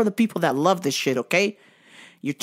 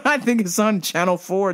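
ordinary talk

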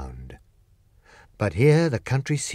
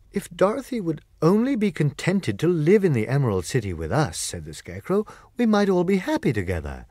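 lightly raised voice for the Scarecrow; friendly straw man musing to the group; a touch higher, hopeful Scarecrow; 7 semitones higher than usual. Acted.

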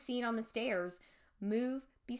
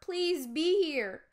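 higher, louder, slow desperate plea; pleading; 7 semitones higher, 6 dB louder, slower than usual. Acted. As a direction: beg the empty room. Pleading for parents to be home.

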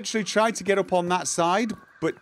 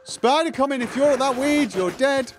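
raised, higher urgent call; urgent warning; 9 semitones higher, 6 dB louder than usual. Real, not acted. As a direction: call out the danger loudly. Spider attacking, warning teammate.

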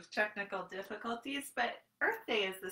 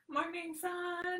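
cheery greeting; 8 semitones higher than usual. Acted. Greeting the sun in yoga.

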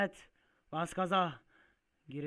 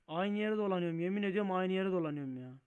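loud, raised-pitch exasperation; frayed nerves; 4 semitones higher, 5 dB louder than usual. Real, not acted. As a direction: protest in real frustration. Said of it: "Sinirlerim bozuldu". (siniri bozuk, yüksek sesle yakınarak)

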